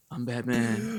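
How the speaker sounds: softly imitated Batman growl, hushed; a light retold quiet Batman imitation; hushed Batman line. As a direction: murmur it the way the actor did. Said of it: "he kinda just whispered his lines like".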